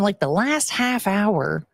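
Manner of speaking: usual speaking voice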